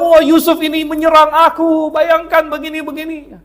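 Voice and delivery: scheming wife's accusation. Retold or imitated. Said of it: "dia bilang".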